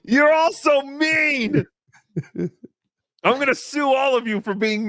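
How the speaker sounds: playful fake sulk, stretched out high; mock hurt. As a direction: whine it like a wounded child. Mock hurt at teasing chatters.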